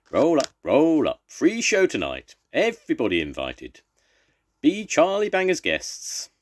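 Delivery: loud, high ringmaster cry; circus barker call. Acted. Charlie Banger invites the town to his show, loud barker cry. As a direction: roll them up like a showman. Circus owner calling the crowd.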